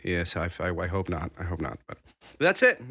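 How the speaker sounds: ordinary talk, nothing marked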